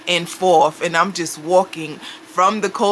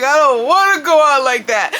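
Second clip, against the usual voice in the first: a retold panicked plea, higher and louder; mock panic; 8 semitones higher, 5 dB louder than usual. Frightened woman alone in the shower, mock panic. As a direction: pitch up, half-acting the dread of dying.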